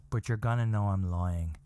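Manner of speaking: low, deliberate mock-solemn line; retold grave admission; imitating grave wife. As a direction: deliver the wife's bad news low and measured. Retells wife's grave answer.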